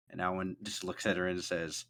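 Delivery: ordinary talk, nothing marked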